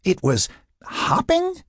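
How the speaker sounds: slow, very high mocking echo; mock him by stretching out "hopping". A narrator's reading. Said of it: "mocking him".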